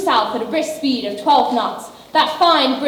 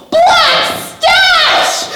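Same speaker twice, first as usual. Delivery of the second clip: dramatic shout; 12 semitones higher than usual. Acted. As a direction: proclaim the name with grand menace. Dramatic villain name reveal.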